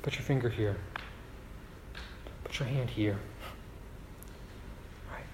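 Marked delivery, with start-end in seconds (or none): 0.0-3.2 gentle Jesus. Retold or imitated. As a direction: coax him kindly. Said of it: "he says".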